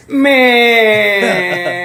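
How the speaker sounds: loud, drawn-out exclamation; exasperated yell. Acted. Comic fed up with rival rappers, long exasperated yell.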